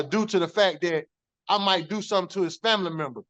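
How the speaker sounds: usual speaking voice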